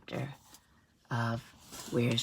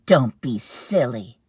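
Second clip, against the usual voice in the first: loud, slow, low chiding; chiding mother; dismissive mom; 4 semitones lower, 11 dB louder, slower than usual. Acted. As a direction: brush it off. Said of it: "said Mom".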